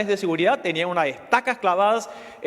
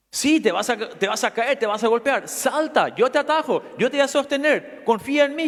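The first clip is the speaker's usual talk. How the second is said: put-on high, coaxing call up to the boy; a stranger pleading with the boy to trust him; urging call; 7 semitones higher than usual. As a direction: keep pressing him to jump. Man urges the boy to jump.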